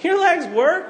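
hammed-up refusal; playful outrage at spouse; mock shout.

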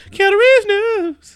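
high, loud, drawn-out sing-out; slow, high mock fanfare; segment announcer. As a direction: herald the segment like a jingle.

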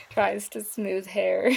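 loud, drawn out through laughter; real laughing. Real, not acted. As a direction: laugh your way through the words. Player cracks up while narrating action.

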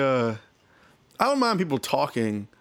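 ordinary talk, nothing marked

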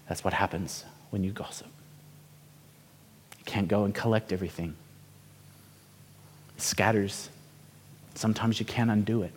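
grandpa quietly teaching his grandson, tender and soft; gentle grandfather. Acted. Grandpa gives the gentle moral.

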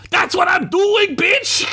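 very high furious squawk; loud, high-pitched outburst; yelled. A narrator's reading. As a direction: shriek the retort in acted fury. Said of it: "Gunk yelled".

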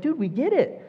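high, mock-exasperated dude. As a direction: roll your eyes at the repeat. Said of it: "It's like".

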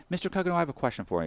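a prim manager rattling it off; lightly voiced prissy manager; mock manager.

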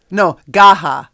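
loud, playful insistence on Gaha; insistent. Real, not acted. Co-host insisting on her pronunciation. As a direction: stand by Gaha loudly. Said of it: "That's what I'm going with".

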